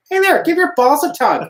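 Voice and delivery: high, loud Canadian twang; Canadian accent. Retold or imitated. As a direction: bark it like a Letterkenny Canadian. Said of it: "he's like".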